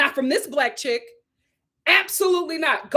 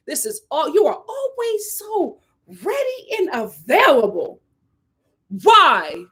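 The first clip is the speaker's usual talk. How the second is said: usual speaking voice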